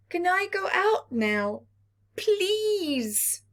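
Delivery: put-on high pleading child; Little Earth begging his parents again; begging little planet. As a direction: draw out the please. Little Earth begs once more.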